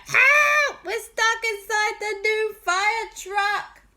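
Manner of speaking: very high, loud trapped yell; cry for help. Acted. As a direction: shout for rescue from inside. Trapped in the fire truck.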